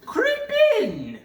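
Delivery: high loud witch order; commanding witch. Acted. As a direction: order her in with menace. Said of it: "said the witch".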